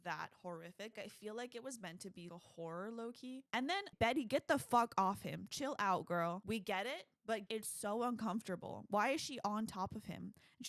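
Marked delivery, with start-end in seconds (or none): none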